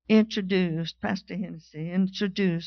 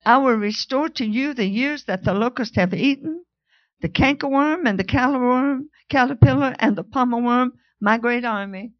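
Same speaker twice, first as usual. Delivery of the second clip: loud, higher fervent scripture preaching; full-force preaching; 4 semitones higher, 7 dB louder than usual. Acted. To the congregation, full-force preaching. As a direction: proclaim it with fire.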